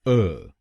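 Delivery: अ as a short neutral uh; a lone crisp "uh"; syllable demo. Shown as a demonstration. Teacher drills third syllable for students, clear demo. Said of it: "हम बोलेंगे अ".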